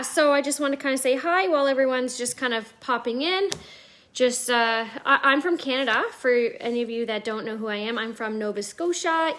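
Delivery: ordinary talk, nothing marked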